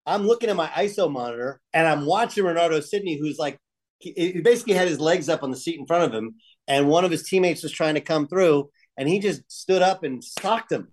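ordinary talk

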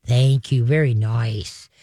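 low, slow, settled praise; calm praise. Shown as a demonstration. Trainer praising a dog that quieted, low calm praise.

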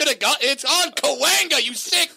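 high, loud, escalating hysterical rant; mock outrage. Co-host playing the outraged dog lover at comic, building hysteria.

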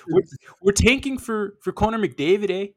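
put-on Canadian with a tagged-on eh; Canadian accent. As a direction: have another go at a Canadian accent. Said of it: "how to do a Canadian accent".

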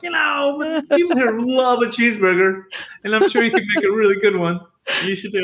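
high, coaxing mimicked voice; well-meaning busybody. Local urging the chef to make cheeseburgers, high busybody voice. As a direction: coax in an exaggerated high pitch.

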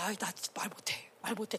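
very low, hushed backing-out; barely voiced, acted excuse; timid reluctance. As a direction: shrink away from speaking up. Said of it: "다들 이러고 있는 거야".

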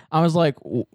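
ordinary talk

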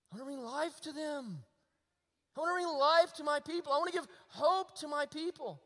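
high, loud, fervent exhortation; ringing pulpit proclamation; full-force preaching. Real, not acted. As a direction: drive it home to the congregation. Preaches God's message.

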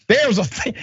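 high-pitched take on the jailer; Paul Watkins. Acted. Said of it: "I'm trying to do it in the spirit of the jailer".

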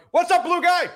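high, loud heckle from the stands; mock yell. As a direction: imitate a fan hollering at a stranger. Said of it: "some guy yells out".

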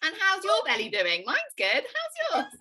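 high, giggling fake small talk; mocking belly comment. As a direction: tease them back, laughing through it. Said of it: "comment on their belly back".

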